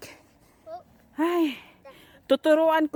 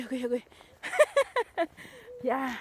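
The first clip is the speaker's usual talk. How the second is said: usual speaking voice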